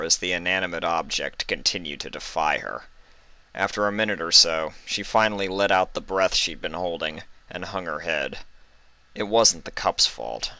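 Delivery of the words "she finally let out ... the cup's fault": ordinary delivery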